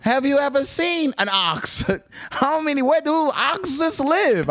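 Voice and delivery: high-pitched, drawn-out incredulous questions; comedian's joke delivery. Retold or imitated. Retelling Cat Williams' bit to friends, high comic impression. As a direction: mimic a comic milking each question, high and slow. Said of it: "He's like".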